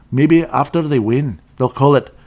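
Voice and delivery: normal talking voice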